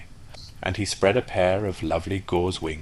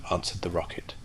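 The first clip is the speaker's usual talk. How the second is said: usual speaking voice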